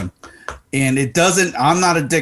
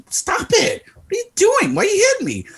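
squeaky high, mock-alarmed; high mock startled protest. Squeaky high protest. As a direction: play your own startled yelp at the friend hitting you. Acting out startled reaction to being hit.

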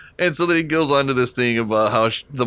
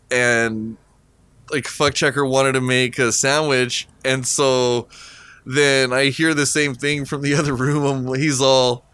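normal talking voice

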